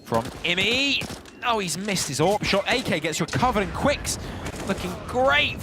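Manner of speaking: rising, hyped play-by-play; esports caster. Real, not acted. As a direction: call the fight as it peaks. Live call of a clutch fight.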